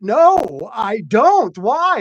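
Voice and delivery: alarmed. Retold or imitated. Alarmed reply to comedian.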